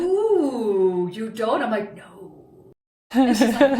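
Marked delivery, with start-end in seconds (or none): 0.0-1.6 teasing surprise. Retold or imitated. Girlfriend's teasing reply, quoted. 2.0-2.7 curt hushed no. Retold or imitated. Her own curt answer, quoted.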